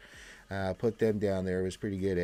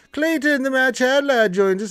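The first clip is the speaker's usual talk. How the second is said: announcer intro; 13 semitones higher, 10 dB louder than usual. Retold or imitated. Announcing a viewer grandly.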